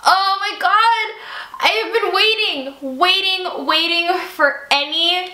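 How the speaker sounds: slow, high squeal of delight; thrilled gasp of joy; excited. Real, not acted. Fan excited about favourite couple.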